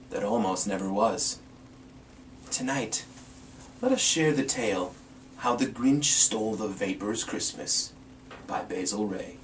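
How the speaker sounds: soft, secretive story opening; hushed storyteller intro. A narrator's reading. Host welcoming a listener to a Christmas tale, hushed. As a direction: draw the listener in quietly.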